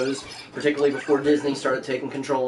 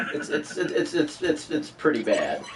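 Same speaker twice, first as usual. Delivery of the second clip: laughing; 2 semitones lower, 4 dB quieter than usual. Real, not acted. Laughing at his bad accent.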